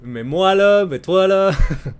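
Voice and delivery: sing-song Québécois "là" endings; playful Quebec "mais toi, là"; Quebec accent. Shown as a demonstration. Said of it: "you add the le".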